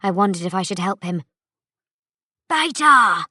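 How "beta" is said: higher, louder call across the hall. A narrator's reading. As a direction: call her urgently from afar. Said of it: "a voice rang out".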